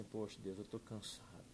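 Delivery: soft, exhausted plea to God; weary prayer. Retold or imitated. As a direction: sigh it out, worn down. Tired prayer to God, retold.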